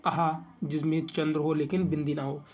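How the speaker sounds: usual speaking voice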